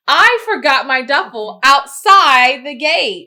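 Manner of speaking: slow, loud, high plea; acted panic. Traveler tells the crew at the front, loud mock panic. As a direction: wail it out, stretching the words. Acts telling crew about lost bag.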